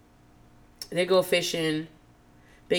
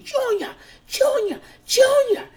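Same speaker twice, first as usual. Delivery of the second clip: high mock screams of the name, building louder; imitating screaming; 3 dB louder, slower than usual. Renee calling Junior in the middle of the night, mock screaming. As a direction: wail the name up high, hammed up.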